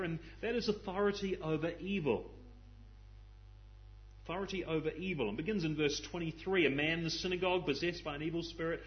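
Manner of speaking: normal talking voice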